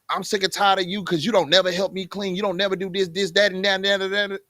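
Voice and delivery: running complaint with no let-up; imitating a fed-up, nagging spouse; nagging wife. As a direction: nag on and on, piling up grievances. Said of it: "now you just sound like you nagging".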